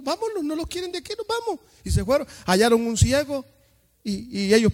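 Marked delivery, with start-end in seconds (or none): 0.0-1.6 higher, quick whiny exit; mock disciples leaving. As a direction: hurry off in a huff. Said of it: "los discípulos salieron". (discípulos ofendidos, rápido y agudo)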